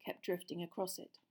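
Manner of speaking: plain reading voice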